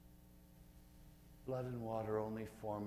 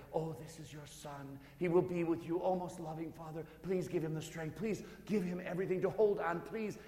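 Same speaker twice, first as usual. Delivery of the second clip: higher, louder pleading mother; Mary's urgent prayer; 10 semitones higher, 8 dB louder than usual. Acted. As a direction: pray harder, rising with each please. Mary prays for the thief.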